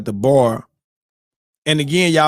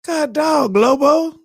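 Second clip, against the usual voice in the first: dismayed; 13 semitones higher, slower than usual. Retold or imitated. A fan let down by the twist, high dismayed cry. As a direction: wail his name. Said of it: "you be like".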